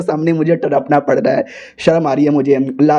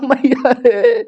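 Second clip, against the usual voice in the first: a higher, strained moan of pain; 5 semitones higher, much slower than usual.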